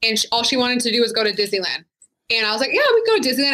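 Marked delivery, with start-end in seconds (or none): 2.7-3.5 much higher, chirpy put-on brightness; forced cheer. Mother retelling her forced cheer to her daughter, bright and high. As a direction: fake a bright yes for the kid.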